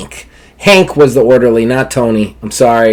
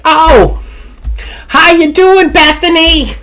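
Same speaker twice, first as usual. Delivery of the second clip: put-on sweet old lady; slower than usual. Old Rebecca greeting Bethany in a high granny voice. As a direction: squeak it like a cheery granny. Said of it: "Rebecca's like".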